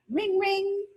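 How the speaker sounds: very high sing-song Ring, ring; bell ring. A narrator's reading. Ridley voices her wheelchair bell, very high and chiming. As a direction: chime it like a bike bell. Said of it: "It rang out".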